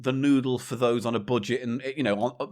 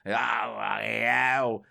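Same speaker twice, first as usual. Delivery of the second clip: put-on drunk curse; slower than usual. Drunk husband dropping snails at his door, slow curse. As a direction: groan it out as the bag drops.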